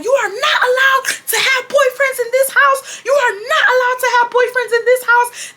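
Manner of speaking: high, wailing imitation of furious mom; hammed-up emphatic scolding; mock screaming. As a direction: lay down the law as a screeching mother. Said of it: "she was screaming, y'all".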